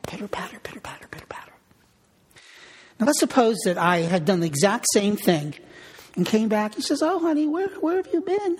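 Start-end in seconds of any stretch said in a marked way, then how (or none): 0.1-1.5 heartbeat pitter patter. Shown as a demonstration. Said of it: "her heart just going like this". 7.0-8.6 high, sweet falsetto wife; wife's voice. Retold or imitated. Pastor voicing his wife greeting him home.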